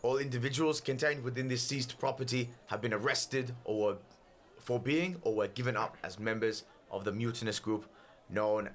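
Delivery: formal, declamatory herald; stern official pronouncement, projected; full-volume royal proclamation. Acted. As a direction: read out a sentence to a whole crowd. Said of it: "still at full volume from his voice".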